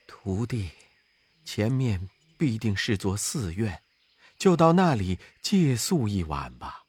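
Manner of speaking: put-on low, soft voice of an old monk; gentle monk. A narrator's reading. Master asks his disciple for food, low and soft. Tang Seng voiced in story.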